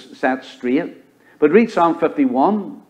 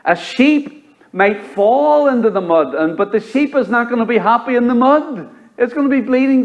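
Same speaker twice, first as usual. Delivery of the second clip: impassioned preaching; 9 semitones higher than usual. Acted. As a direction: paint the sheep's misery with fervor. Preacher pictures the sheep.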